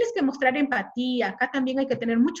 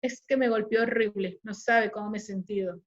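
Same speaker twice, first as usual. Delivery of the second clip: downcast account; 2 semitones lower than usual. Shown as a demonstration. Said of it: "a que una mujer te lo diga".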